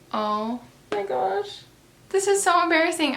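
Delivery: mortified. Retold or imitated. Her inner reaction after he says no to the kiss, hammed-up mortified. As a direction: wail it like you want to disappear.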